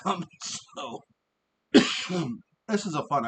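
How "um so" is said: ordinary talk, nothing marked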